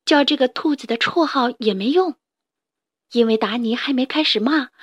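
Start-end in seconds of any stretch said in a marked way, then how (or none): none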